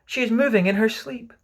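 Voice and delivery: high, thrilled report; lifted, eager announcement; excited shout. A narrator's reading. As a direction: share the discovery urgently. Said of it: "shouted another".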